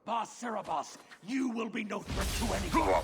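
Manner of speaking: high, loud roar of a death threat; furious threat. Acted. Arvarad sentencing the mage to death in a furious roar. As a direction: bellow the threat as he attacks.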